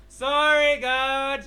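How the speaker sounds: loud. Acted. Apologising loudly to God.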